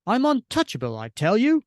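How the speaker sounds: high, loud boast; bragging out loud to the crowd; boastful. Acted. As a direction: crow it proudly, high and punchy. Off-panel boast voiced in comic.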